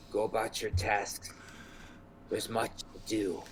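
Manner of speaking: halting orders with long pauses; composed wizard. Acted. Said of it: "you see him take a deep breath, and he says".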